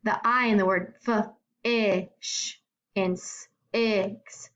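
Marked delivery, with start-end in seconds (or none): 1.1-2.6 "fish" slowly split into sounds; fish sounded out. Shown as a demonstration. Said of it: "makes its short sound". 3.1-4.5 "six" slowly split into sounds; six sounded out. Shown as a demonstration. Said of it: "makes its short sound".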